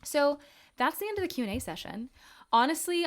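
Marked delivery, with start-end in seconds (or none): 2.5-3.1 emotional. Real, not acted. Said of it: "getting a little bit emotional there".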